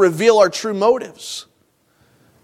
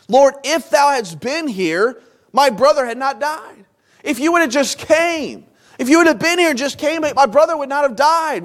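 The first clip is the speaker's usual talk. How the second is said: higher-pitched grieving complaint; mildly acted reproach, high-pitched; aggrieved pleading; 6 semitones higher than usual. Voicing Martha's complaint to Jesus.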